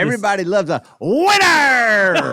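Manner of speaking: hugely stretched, loud "winner"; mock the flub with a long, booming "winner"; stress on winner. Co-host teasing the host's flubbed line, exaggerated stress on "winner". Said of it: "I emphasize winner".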